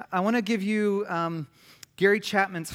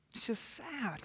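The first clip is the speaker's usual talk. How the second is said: hushed, higher-pitched grief; real sadness; 4 semitones higher, 9 dB quieter than usual. Real, not acted. Parent grieving over a daughter moving on, softly sad. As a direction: let the grief thin the voice to a soft, high murmur. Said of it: "like, grieving".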